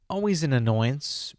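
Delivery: usual speaking voice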